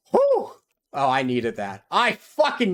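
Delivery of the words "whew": very high, loud exhale of relief; relieved whoop. Real, not acted. Gamer whooping in relief after surviving the gank. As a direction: let the tension go in one cry.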